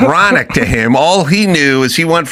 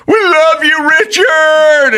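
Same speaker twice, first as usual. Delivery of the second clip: high, loud shout from the bleachers; retold crowd yell; 13 semitones higher, 4 dB louder, slower than usual. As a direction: yell it across the gym. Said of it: "just yelled".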